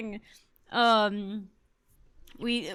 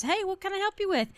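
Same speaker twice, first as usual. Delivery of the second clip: high-pitched, chipper customer-service imitation; put-on high helper voice; imitated helpful coworker; 10 semitones higher than usual. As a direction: pitch it way up as a retold offer of help. Coworker offering help, voiced in story.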